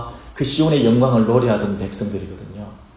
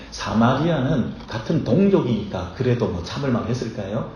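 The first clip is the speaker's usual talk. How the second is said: ordinary talk, nothing marked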